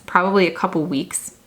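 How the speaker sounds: ordinary talk, nothing marked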